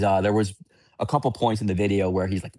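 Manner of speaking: normal talking voice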